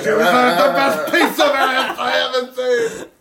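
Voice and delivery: high, slow, loud studio boss; Jack Woltz voice. Pretend. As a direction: bluster like an old Hollywood mogul. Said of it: "throw Jack Woltz impressions".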